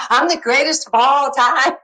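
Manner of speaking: high, loud bragging with a laugh; cocky boast. Retold or imitated. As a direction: throw the boast right back at the champ.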